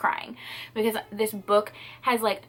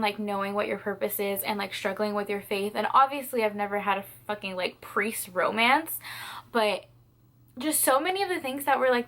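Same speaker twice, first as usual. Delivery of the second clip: ordinary delivery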